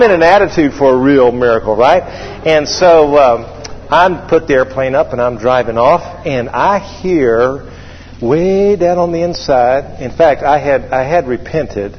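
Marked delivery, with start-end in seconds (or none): none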